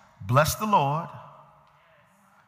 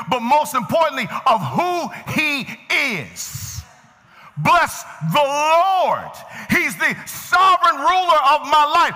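full-force preaching; 6 semitones higher than usual. Real, not acted. Preacher proclaiming the Lord as sovereign ruler, full-force preaching. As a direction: preach it strong, stretching the weightiest words.

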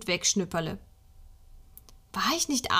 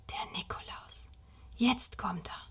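breathless, hushed whisper to himself; awed whisper; 2 semitones higher, 8 dB quieter than usual. A narrator's reading. Little boy whispering in bed, hearing Nikolaus in the house. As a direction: whisper it so nobody hears.

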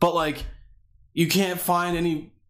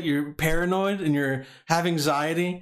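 ordinary delivery

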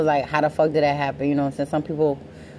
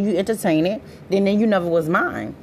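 normal talking voice

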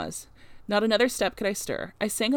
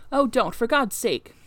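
louder, higher, drawn-out pleading; desperate plea; 4 semitones higher, 5 dB louder, slower than usual. A narrator's reading. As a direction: beg slowly not to be shut out. Turned-away beggar pleads at the door.

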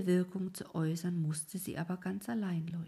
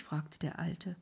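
ordinary delivery, nothing marked

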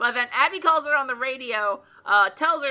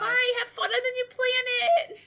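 high laughing mock farewell; bubbly sorority girl; 12 semitones higher than usual. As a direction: chirp it through laughter.

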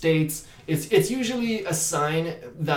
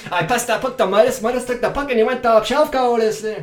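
put-on loud, higher, fast English with thick Québécois accent; Quebec accent hockey talk; 8 semitones higher, 7 dB louder, faster than usual. Actor voicing a Quebec hockey player, loud Québécois accent. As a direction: brag about the goal like a hockey guy from Quebec. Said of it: "French from, like, Quebec".

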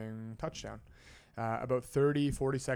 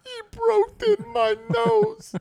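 much higher, much louder, slow wailing complaint; Swatowski crying; 9 dB louder, slower than usual. Retold or imitated. Injured player after a hit, high wailing complaint. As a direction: wail it like a grown man in tears. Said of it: "the infamous line".

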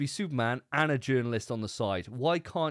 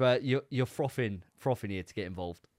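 ordinary talk

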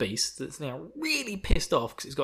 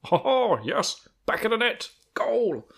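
lightly voiced Alan Partridge catchphrase; a hint of Alan Partridge, high and chirpy; mock Partridge cheer; Alan Partridge impression; 10 semitones higher, 4 dB louder, slower than usual. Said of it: "is Alan Partridge".

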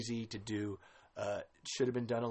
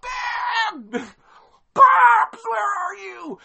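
much higher, much louder hammed-up cry for dad; frantic shouting; 15 dB louder, much slower than usual. Imagined dub of son searching for his dad, hammed-up frantic shout. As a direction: wail for a lost father, over the top. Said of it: "A D R that shit over top".

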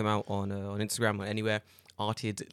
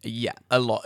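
ordinary delivery

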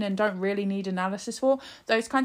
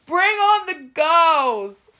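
higher, louder, slow jeering heckle; rowdy troops; 9 semitones higher, 5 dB louder, slower than usual. Retold or imitated. Troops heckling Captain America's show, loud mock jeer. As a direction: bellow it like bored soldiers in the crowd. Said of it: "the troops are like".

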